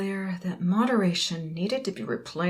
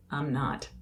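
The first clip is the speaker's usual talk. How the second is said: ordinary delivery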